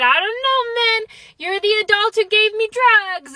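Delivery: whiny high-pitched defence, imitating a drugged patient; high, helpless protest. Patient answering frustrated dentist, high helpless protest. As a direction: protest it high, as if not your fault.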